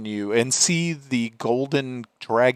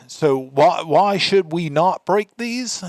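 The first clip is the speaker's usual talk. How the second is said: higher, louder commander's challenge, drawn out at the end; questioning commander; 7 semitones higher, 5 dB louder, slower than usual. Acted. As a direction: demand an explanation as the commander. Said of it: "looks up very quickly at you".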